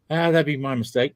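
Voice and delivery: normal talking voice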